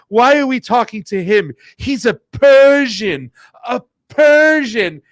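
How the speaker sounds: high, loud, slow mock outrage; angry diplomat. Host mimicking an angry Saudi diplomat, high and loud. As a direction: spit out Persian like an insult. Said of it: "got so pissed off".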